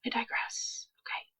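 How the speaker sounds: soft unvoiced whisper. Real, not acted. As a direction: breathe it out as a whisper. Aside repeated, second take whispered.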